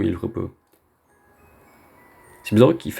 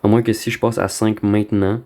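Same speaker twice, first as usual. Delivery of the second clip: ordinary talk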